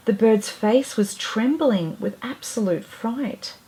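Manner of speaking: ordinary delivery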